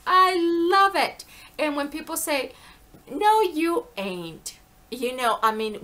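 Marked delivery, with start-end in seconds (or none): 0.1-1.2 loud, high, drawn-out delight; gleeful exclamation. Real, not acted. Exclaims delight about accents again. 3.1-4.6 loud, high, drawn-out Southern denial; Southern accent. Retold or imitated.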